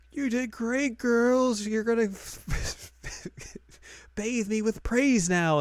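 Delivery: high, gushing teacher imitation; Miss Yamanaka. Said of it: "Miss Yamanaka's like".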